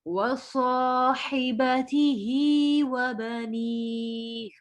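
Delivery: slow, melodic tajweed chanting; Quran recitation. Shown as a demonstration. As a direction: draw the vowels out in a slow recitation.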